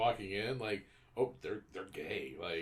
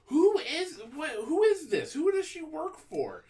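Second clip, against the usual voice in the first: incredulous; 14 semitones higher, 8 dB louder than usual. Real, not acted. Baffled by the plot.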